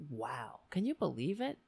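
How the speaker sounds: usual speaking voice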